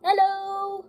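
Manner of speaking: high, loud peep peep; chirpy little engine voice; cheerful engine whistle. A narrator's reading. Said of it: "and go".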